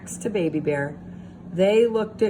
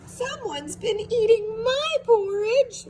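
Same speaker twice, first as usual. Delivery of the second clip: very high crying squeak; squeaky Baby Bear. A narrator's reading. Baby Bear finding his porridge gone, high tearful squeak. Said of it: "squeaked Baby Bear".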